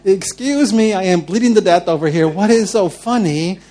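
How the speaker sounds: loud, high exasperated protest; acted indignation. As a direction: demand it, stretching the final question in disbelief. Wounded speaker confronts laughing Jesus.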